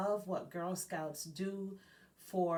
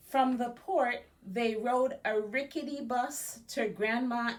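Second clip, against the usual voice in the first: lively storybook narration, a bit louder; reading to children; 4 semitones higher, 5 dB louder than usual. A narrator's reading. Librarian reading a biography aloud to Girl Scouts, lively storybook voice. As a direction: read it like a picture book to kids. Reads picture book aloud.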